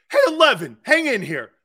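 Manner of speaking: high, loud call to a co-worker. Real, not acted. Host hailing Eleven across the set, high loud call. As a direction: yell his name to pull him in.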